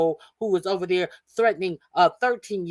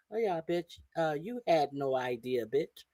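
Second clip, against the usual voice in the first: low contempt; 5 semitones lower, 10 dB quieter than usual. Real, not acted. Quiet contempt after gloat.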